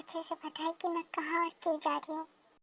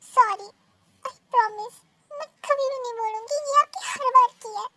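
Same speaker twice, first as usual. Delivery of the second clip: loud, high tearful wail; crying; 7 semitones higher, 10 dB louder than usual. Acted. Crying girl throwing his promise back at him, tearful. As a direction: sob out the promise through tears.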